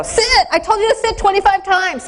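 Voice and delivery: screaming at dog. Retold or imitated. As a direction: lose your patience with the puppy. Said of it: "you don't scream at 'em".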